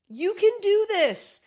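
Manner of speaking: you can do this, high and loud; pep talk cheer. Shown as a demonstration. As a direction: cheer them on, rising up on can. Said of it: "the pep talk part is like".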